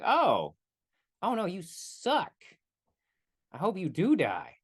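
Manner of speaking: slow, drawn-out put-down; lightly played disgusted reaction; mock disdain. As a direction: act out a viewer turning on a character. Voicing viewer reacting to characters.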